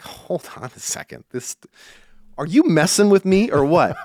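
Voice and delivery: half-laughing, rising to a loud incredulous what; stunned disbelief. Retold or imitated. To the organisers, loud stunned disbelief. Retelling his disbelief at booking.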